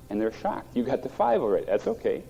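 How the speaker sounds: usual speaking voice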